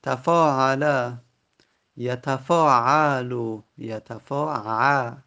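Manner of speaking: تفاعل and یتفاعلو said slowly and loud; slow Arabic forms. Shown as a demonstration. Teacher showing students the present form, slow and loud. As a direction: give یتفاعلو slowly, clearly stressed. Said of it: "teacher spells out present form".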